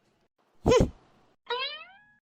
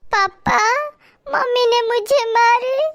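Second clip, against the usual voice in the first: put-on higher child voice, slow tattling; complaining child; 6 semitones higher, slower than usual. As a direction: tell papa mummy hit her. (पापा से शिकायत करती बच्ची)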